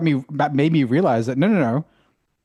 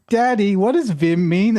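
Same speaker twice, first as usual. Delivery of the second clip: put-on higher, slow childish question; mock child; 7 semitones higher, slower than usual. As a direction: ask like a small curious kid. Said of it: "a kid going".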